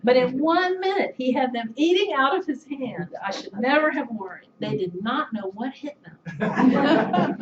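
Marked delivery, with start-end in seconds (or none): 4.6-7.4 laughing slowly. Real, not acted. Proud story of father's speech.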